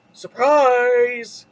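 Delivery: big, loud storybook shout; loud drawn-out call; Beast calling out. A narrator's reading. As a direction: boom it out to spring a surprise. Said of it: "called the Beast".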